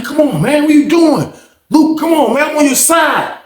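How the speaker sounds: high-pitched imitation of a man protesting; mock pleading. Randall to Luke after the push, mock pleading.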